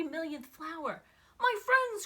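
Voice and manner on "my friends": much louder, higher glad shout; joyful cry. Acted. Horton finding the Whos at last, joyful cry. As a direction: cry out in relief. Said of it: "cried the elephant".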